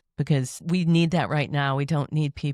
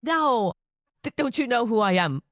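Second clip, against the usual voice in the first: mock indignant ego; 6 semitones higher than usual. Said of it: "that ego voice".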